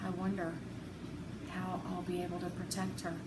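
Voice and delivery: ordinary delivery, nothing marked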